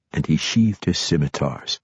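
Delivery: ordinary delivery, nothing marked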